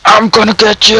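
high-pitched playful threatening yell; battle cry. Pretend. Gamer threatening an opponent, battle cry. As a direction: yell it like charging at a rival. Threat repeated, escalating in game.

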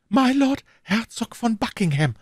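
ordinary delivery, nothing marked

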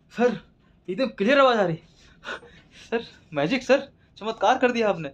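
high and loud, overjoyed; amazed delight. Acted. Patient hears clearly again and praises the doctor's magic. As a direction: gush with amazement.

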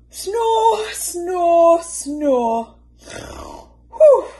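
high, loud snores fading, then a whistling breath out; comic snoring run. Acted. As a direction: snore over and over, ending in a whistle. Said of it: "went Missus Bear".

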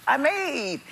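high-pitched hurt disbelief, retold; genuinely hurt. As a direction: act out being wounded by a snub. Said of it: "I was genuinely hurt".

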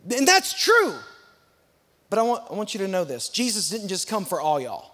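ordinary talk

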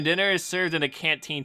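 ordinary delivery

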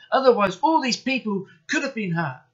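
keyed-up high little-engine voice; urgent relief. A narrator's reading. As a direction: exclaim at the near miss. Twins after the narrow escape.

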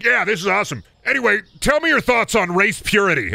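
high mock customer voice; high, cheerful fake friendliness; quoting a customer. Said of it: "where they'd be like".

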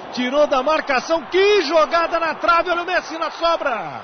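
fast, building play-by-play; rising excitement. Acted. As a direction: build up to the goal. Build to goal. (narração empolgada crescendo)

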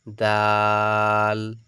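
দাল with a very long loud "aa"; drawn-out vowel. Shown as a demonstration. Teacher models for students, দাল drawn out long. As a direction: hold the vowel of দাল steady. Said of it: "এগুলো একটু করে টানবেন".

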